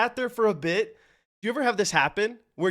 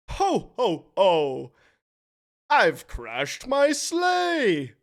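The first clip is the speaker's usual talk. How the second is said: Santa Claus; 2 semitones higher, much slower than usual. Pretend. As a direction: boom it out like a dazed Santa who crashed his sleigh. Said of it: "pretend to be Santa Claus".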